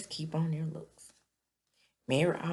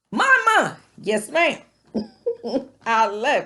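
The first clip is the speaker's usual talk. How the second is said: loud, much higher cheering yell; cheering shout; 8 semitones higher, 13 dB louder than usual. Real, not acted. Vlogger cheering her mom's insult, excited shout. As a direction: whoop for her like a fan in the stands.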